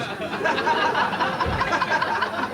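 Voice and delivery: giggly high unvoiced cackle; wheezing laugh up high; high breathless cackle. Comic laugh after the barber joke.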